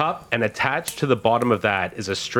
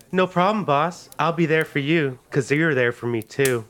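courtesan; 4 semitones higher than usual. Acted. Game master voices courtesan replying.

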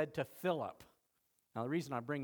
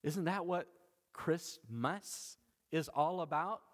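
usual speaking voice

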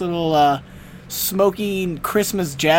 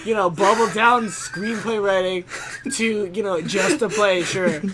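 mock indignant; 5 semitones higher, 3 dB louder than usual. Mock-indignant defending screenplays.

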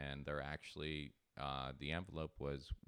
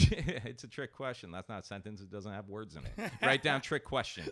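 higher and louder, laughing declaration; mock indignant; 4 semitones higher, 5 dB louder than usual. Dad telling his son the homework is a trick question, amused. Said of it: "I'm like".

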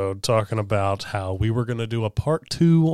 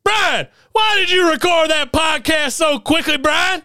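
drill sergeant; 4 dB louder than usual. Acted.

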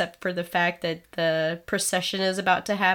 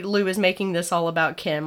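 normal talking voice

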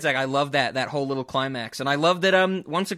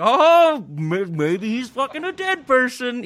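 high, loud, slow and hammed up; a stunned fan's exaggerated gasp; mock astonishment; 10 semitones higher, 6 dB louder, slower than usual. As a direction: gasp it out in fake wonder. Mock audience guessing, absurd.

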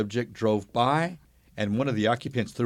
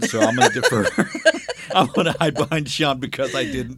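laughing through the words; giggling while ducking behind Sean; 4 semitones higher, 7 dB louder than usual. Real, not acted. Dodging the dialect question, laughing.

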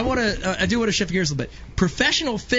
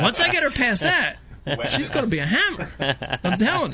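laughing; 6 semitones higher than usual. Real, not acted. Laughing after the impression.

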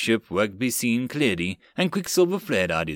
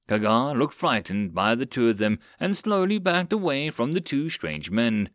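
usual speaking voice

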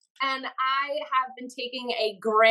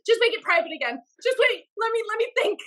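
higher, flustered backpedaling; panicky second thoughts; 10 semitones higher, faster than usual. Retold or imitated. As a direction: take it back in a nervous rush. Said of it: "and I go".

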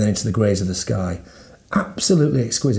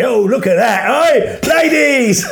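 very high, loud catcalling barker; street hawker; 12 semitones higher, 6 dB louder, slower than usual. Pretend. Hawker calling to the ladies, mock market shout. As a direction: call out over the crowd, hammed up.

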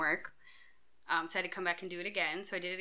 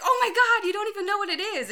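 high, loud retold exasperation; worked-up quote of herself arguing; exasperated outburst; 9 dB louder than usual. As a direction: cry it out in high-pitched disbelief. Retold quarrel with her husband.

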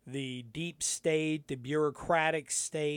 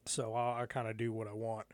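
forcing a deep voice; 5 semitones lower, 8 dB quieter, faster than usual. Shown as a demonstration. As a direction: fake deep voice, lower and quiet. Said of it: "a really fake deep voice".

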